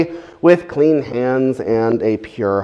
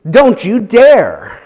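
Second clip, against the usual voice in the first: higher and louder, slowing on don't you dare; protesting loudly; 7 semitones higher, 6 dB louder than usual. Retold or imitated. Preacher acting Peter refusing Jesus, loud protest. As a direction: refuse him outright, voice raised. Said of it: "Peter protests all the more".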